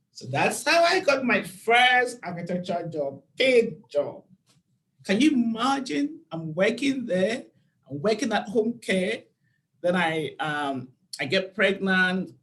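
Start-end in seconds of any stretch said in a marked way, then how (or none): none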